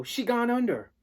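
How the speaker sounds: high, loud call to his dad; urgent call. Acted. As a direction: burst in calling for dad. Boy reports sinking.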